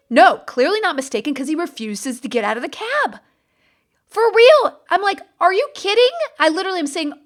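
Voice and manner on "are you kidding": incredulous. Retold or imitated. Retold outrage at the line-cutter.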